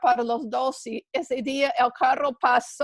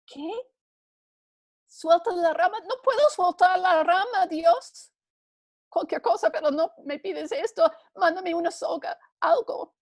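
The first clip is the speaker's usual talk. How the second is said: panicked protest; 5 semitones higher than usual. Acted. Jack refusing, panicked.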